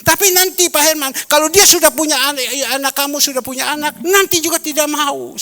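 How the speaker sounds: put-on preacher warning his friend about her children; retold warning. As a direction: warn the friend forcefully. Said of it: "saya bilang".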